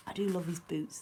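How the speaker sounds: ordinary delivery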